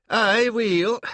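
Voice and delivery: put-on loud, high burst in a Scottish accent; booming Scottish exclamation. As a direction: greet them heartily in a Scottish accent. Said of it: "in that astonishing accent".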